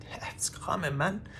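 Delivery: usual speaking voice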